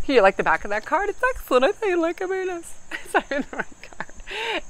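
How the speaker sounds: high squeaky voice cracking up; laughing too hard at a silly slip; giddy laughing. Real, not acted. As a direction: squeal it through real laughter. Joking about showing wrong card.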